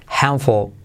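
ordinary delivery

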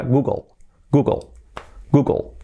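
Google as "GOO-gol", L barely heard; English Google, one word at a time; almost silent L. Shown as a demonstration. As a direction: repeat Google with a light final L. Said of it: "Fijaos que la L casi no se".

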